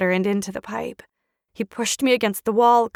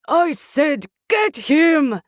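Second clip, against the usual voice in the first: angry repeated order; 5 semitones higher, 4 dB louder, slower than usual. Acted.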